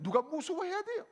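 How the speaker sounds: ordinary delivery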